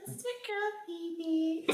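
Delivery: very high, squeaky self-introduction; playful falsetto hello to the listeners; put-on intro voice. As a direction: introduce yourself in a tiny, high-pitched voice. Puts on voice to introduce herself.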